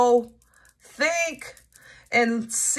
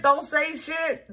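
usual speaking voice

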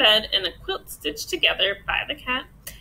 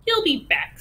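higher, indignant cat voice; storming cat; 5 semitones higher, 4 dB louder than usual. A narrator's reading. Cat to the departing duck, storming retort. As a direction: throw it after someone in a mild storm. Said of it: "stormed the cat".

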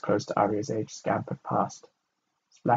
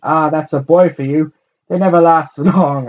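loud, high mocking laugh through the words; 6 semitones higher, 12 dB louder than usual. A narrator's reading. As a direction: laugh at the boy king. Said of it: "as she laughed".

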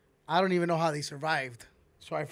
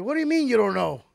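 higher, louder stern cop demanding answers; angry trooper; 5 semitones higher, 6 dB louder than usual. Retold or imitated. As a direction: scold him like a mad trooper. Trooper pressing him.

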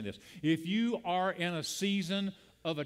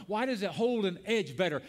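ordinary talk, nothing marked

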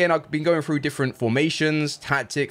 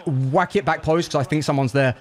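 ordinary talk

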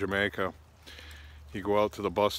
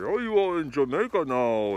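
higher, louder mock tour-guide voice; bus driver on the mic; 7 semitones higher, 5 dB louder than usual. Driver greeting tourists over the microphone, mock hype voice. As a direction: hype up the passengers. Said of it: "He's got the microphone telling everybody".

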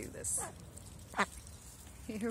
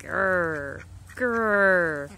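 loud mock growls, the second louder; playful growl; 9 dB louder than usual. Growling back at the attacking duck, hammed up. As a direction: growl back like a toy monster, building.

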